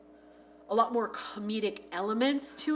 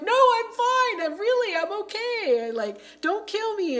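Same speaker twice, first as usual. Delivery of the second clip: much higher and louder, slow desperate squeal; Mickey squealing high and slow, begging for his life; pleading he's fine; 12 semitones higher, 6 dB louder than usual. Retold or imitated. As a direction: beg them not to kill you. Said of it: "and he's like".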